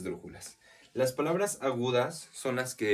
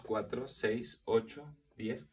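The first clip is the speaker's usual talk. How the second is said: usual speaking voice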